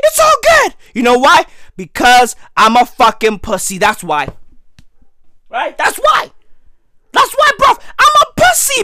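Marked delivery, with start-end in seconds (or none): 0.0-0.7 very high, strained squeak; losing his voice. Real, not acted. Comic reassuring listeners while losing his voice, strained high. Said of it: "I'm losing my voice". 5.8-8.8 high, strained insistence; losing his voice. Real, not acted. Comic explaining himself while losing his voice, strained high. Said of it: "I'm losing my voice".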